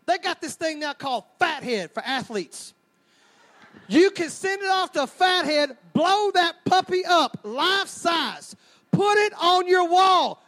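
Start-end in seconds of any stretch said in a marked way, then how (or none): 3.9-10.4 higher, louder and slow, building to a shout; excited build. Real, not acted. Preacher hyping a life-size baptism poster, excitedly building to a shout. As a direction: sell it like a giant prize.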